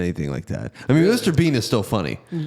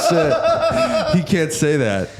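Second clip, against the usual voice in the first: high, laughing mock shock; laughing oh shit; laughing disbelief; 7 semitones higher, slower than usual. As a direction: blurt it out through a laugh. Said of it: "and I was like".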